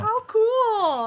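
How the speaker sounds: high, loud delight; excited. Real, not acted. Reacting to the sister detail.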